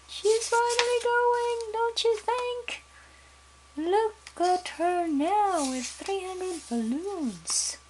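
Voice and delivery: high, slow delighted wonder; delighted aside. Real, not acted. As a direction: marvel at her floating away. Narrator delights at floating girl.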